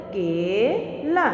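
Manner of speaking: केला stretched out slowly; teacher slowly saying the blended word केला; slow demo word. Shown as a demonstration. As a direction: say केला slowly so children copy it. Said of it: "समझ में आया बच्चों".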